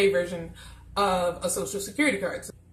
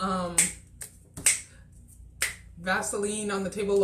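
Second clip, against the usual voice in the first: ordinary talk, nothing marked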